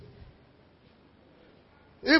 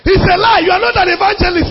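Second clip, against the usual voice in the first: high, emphatic pulpit rebuke; forceful rebuke; 7 semitones higher, 4 dB louder than usual. Real, not acted. Pastor rebuking a false evangelist, forceful high rebuke. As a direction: refute it hard, pitch jumping up.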